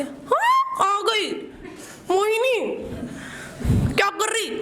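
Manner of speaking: mock-sweet high sing-song jibe; sweetly taunting teacher. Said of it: "उनकी आवाज़ इतनी सुरीली, उनके ताने इतने मीठे".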